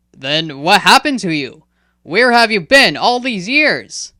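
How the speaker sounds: loud, insistent questioning; pressing. Acted. Hyaktu demanding answers from Kaokat, pressing. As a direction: press him hard for answers. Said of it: "Hyaktu pressed".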